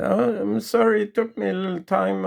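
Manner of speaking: devoted wife calling down; sweet wife. Acted. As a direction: answer him lovingly. Wife apologizing.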